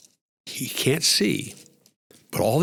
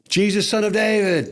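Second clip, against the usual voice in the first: shouting; 11 semitones higher than usual. Retold or imitated. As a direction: call out over the crowd to be heard. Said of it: "and I go from shouting".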